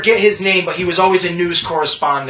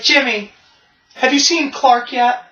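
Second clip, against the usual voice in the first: raised, high-pitched plea; mock-worried Lois, voice pitched up; anxious Lois Lane; 8 semitones higher than usual. Woman fretting to Jimmy at Superman's funeral, mock anxious. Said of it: "she goes up to Jimmy Olsen and she's like".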